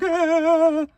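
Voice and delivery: high mock wail; imagine bursting into a high little cry; pretend crying wail. Narrator picturing getting punched, high mock wail. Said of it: "would have cried and be like".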